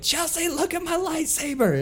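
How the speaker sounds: high, excited call to the other room; excited yell. Retold or imitated. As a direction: squeal it out like a kid showing off a new toy. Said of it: "yelling from the other room".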